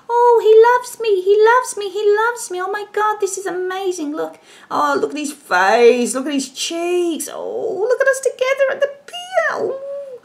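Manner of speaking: high, breathless lovestruck gushing; dreamy swooning girl. Retold or imitated. Reader voices the smitten client, high dreamy swooning. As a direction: swoon in a sky-high besotted voice. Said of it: "sitting there and thinking".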